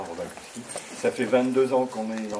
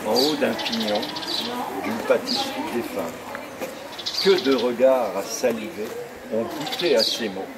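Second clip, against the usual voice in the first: poem recitation; 3 dB quieter than usual. Acted. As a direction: savour each line of your own verse. Recites his poem on tour.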